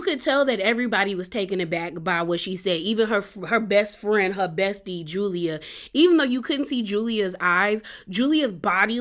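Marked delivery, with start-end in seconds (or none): none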